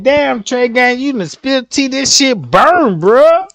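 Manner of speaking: like a stunned viewer yelling; hyped viewer reaction. Retold or imitated. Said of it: "everybody finna be like".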